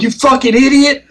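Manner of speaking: hammed-up raid leader berating the team; imitating angry raid leader. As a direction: raid leader yelling at guild mates, mock angry.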